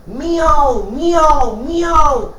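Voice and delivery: high mewing miau; cat meowing. Acted. The cat sings along with high meows. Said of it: "die Katze".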